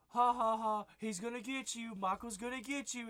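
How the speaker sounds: high mocking laugh, then singsong threat; taunting laugh. Kid taunting the babysitter through the door, mocking. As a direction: tease him with a nasty laugh.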